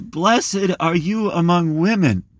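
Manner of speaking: high, slow proclamation; cries out. Acted. Elizabeth greeting Mary, slow high cry. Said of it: "cries out in a loud voice".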